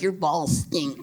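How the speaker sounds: low, soft mock woman's voice; muttered complaint. Girlfriend telling him quietly his balls stink. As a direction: mumble it as the girlfriend. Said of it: "she's like".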